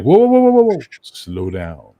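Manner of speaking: startled cry to hold off; alarmed whoa. Retold or imitated. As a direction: rush to stop him before he drinks. Host stops him drinking scotch.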